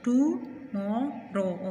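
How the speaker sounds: "Tomorrow" very slowly as टू, मो, रो; three drawn-out, separate beats in "tomorrow"; split into syllables. Shown as a demonstration. Teacher showing students how, "tomorrow" in three slow parts. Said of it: "किस तरह?".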